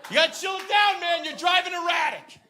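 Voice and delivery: high, loud, insistent shouting; provoking yell. Acted. Driver goading an angry father, provoking high yell. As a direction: shout it over to infuriate him further. Keeps yelling the annoying phrase.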